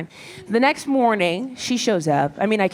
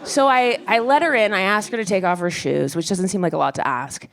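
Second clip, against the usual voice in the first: ordinary talk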